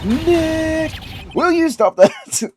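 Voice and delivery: high, drawn-out scold; high-pitched, slow telling-off; exasperated scolding. Acted. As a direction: stretch the name out, then scold him. Scolding him for shouting at camera.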